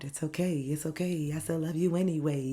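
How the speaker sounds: breathy whispered reassurance; soft whisper. Pretend. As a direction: hush it like soothing a scared child. Repeats comfort to inner child softly.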